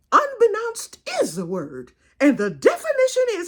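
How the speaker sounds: high, loud, emphatic lecturing aunt; Aunt Ada. Retold or imitated. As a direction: imitate a proper aunt grandly announcing a definition. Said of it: "That's just how she talks".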